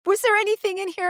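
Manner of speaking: high, quick, urgent question; retold panic, pitched far up and rushed; frantic questioning. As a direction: press him in mock panic.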